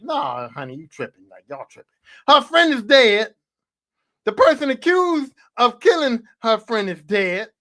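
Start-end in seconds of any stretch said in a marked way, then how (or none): 0.0-1.9 much lower and softer, brushing her off; low, hushed mock mother waving it away; dismissive mom. Mom brushes off her scared daughter, in a low, hushed dismissive voice. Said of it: "You just like".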